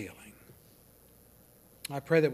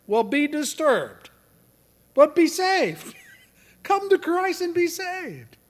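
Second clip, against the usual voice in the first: high, loud, drawn-out exhortation; full-force preaching; 10 dB louder, slower than usual. Real, not acted. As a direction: cry out an urgent altar call, slowly. Preaches an urgent call to salvation.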